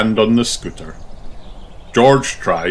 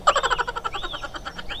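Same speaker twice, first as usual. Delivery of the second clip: giggle; 9 dB quieter than usual. A narrator's reading. Children laughing.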